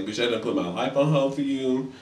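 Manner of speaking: ordinary delivery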